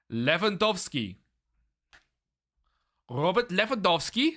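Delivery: careful mock-Polish Lewandowski, w as v; Polish pronunciation. Shown as a demonstration. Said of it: "Basically Polish".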